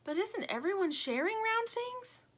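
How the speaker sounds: high, questioning character voice; doubting toy buffalo. A narrator's reading. Lumpy doubts Stingray's explanation, puzzled.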